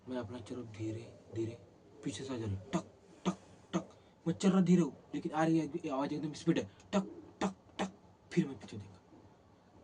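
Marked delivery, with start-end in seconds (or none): 2.7-4.0 crisp tapping footstep sounds; imitated footsteps. 6.6-7.9 crisp tapping footstep sounds; imitated footsteps.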